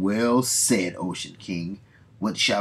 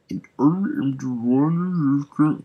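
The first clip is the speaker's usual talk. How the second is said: loud, slow robotic villain voice; first stab at a mechanical voice; metallic voice; 6 semitones higher, 7 dB louder, slower than usual. Acted. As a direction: try out a metallic villain boom. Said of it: "I was trying to do a metallic voice here".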